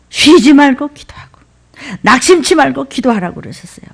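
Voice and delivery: ordinary talk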